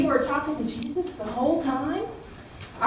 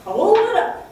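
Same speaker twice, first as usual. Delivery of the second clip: higher and louder, a cue; call out; 9 semitones higher, 7 dB louder than usual. Shown as a demonstration. Teacher showing children the cue to raise pictures, calling out. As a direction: call it out like a cheerful signal. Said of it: "I'm gonna say".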